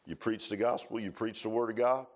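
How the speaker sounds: ordinary talk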